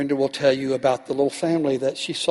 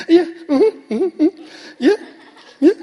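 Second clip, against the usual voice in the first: high giggling phone chatter, much higher and louder; imitating giggly phone girl; 13 semitones higher, 6 dB louder, slower than usual. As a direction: mimic the girl laughing on the phone, ignoring him. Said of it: "this is a good imitation".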